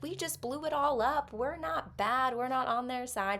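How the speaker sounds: high, pleading retold plea; pleading protest. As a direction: squeak it out as if begging to be believed. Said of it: "they can be like".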